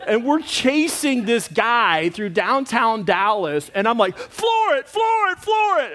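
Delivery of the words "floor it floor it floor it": high frantic shouted chant; repeated shout. Retold or imitated. Husband urging his wife during the car chase, high repeated shouting. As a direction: hammer it three times, urgent. Chasing the driver through Dallas.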